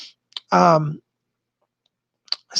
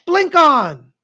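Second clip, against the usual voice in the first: higher, louder triumphant call; excited call; 7 semitones higher, 6 dB louder than usual. Acted. Ending the countdown for her viewers, a high excited call. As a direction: burst it out like switching on a light. Climax of meditation.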